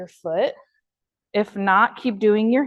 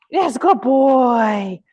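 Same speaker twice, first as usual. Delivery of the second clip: drawn-out happy praise; excited praise; 3 semitones higher, 6 dB louder, much slower than usual. Real, not acted. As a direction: lift the voice to reward him. Praising dog for touch.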